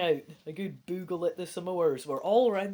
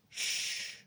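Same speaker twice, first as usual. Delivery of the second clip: soft, toneless shush; hushing; 7 dB quieter than usual. A narrator's reading. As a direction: hush someone about to scream. Creature hushes camper about to scream.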